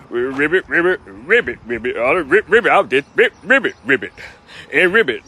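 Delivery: croaked ribbits like a frog in court; frog croaking. Pretend. The frog answering the judge in court, croaking ribbits. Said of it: "he be talking like that".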